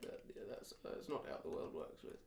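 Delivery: very soft, voiceless mumble; low, barely articulated murmur; mumbled lapse. Pretend. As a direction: let the words drop away under your breath. Joke lapse back into mumbling.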